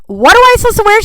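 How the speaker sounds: high, loud panicked "what do I wear"; sudden wardrobe panic, voice jumping up; panicked question. Retold or imitated. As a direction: blurt it out in a high-pitched panic.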